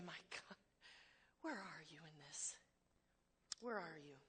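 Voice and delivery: soft, low plea to God; quiet plea. Pretend. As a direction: murmur the question to God. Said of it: "can we say".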